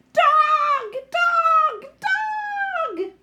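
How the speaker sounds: very high, loud troll wail. Acted. Trolls wailing after the girl flies off with the dog, very high and loud. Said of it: "cries change to wails of".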